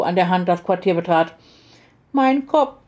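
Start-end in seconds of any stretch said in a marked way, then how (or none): 2.1-2.8 high, plaintive little-girl voice, lightly acted sob; sobbing child. A narrator's reading. Little girl complains of her sore head, mildly sobbing. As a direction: whimper it like a hurting child. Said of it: "schluchzte die Kleine".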